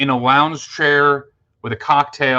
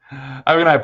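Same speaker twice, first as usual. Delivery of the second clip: normal talking voice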